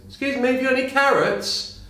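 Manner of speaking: high voiced rabbit asking for carrots; talking rabbit. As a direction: pipe up high like a tiny rabbit. Joke, rabbit asks for carrots.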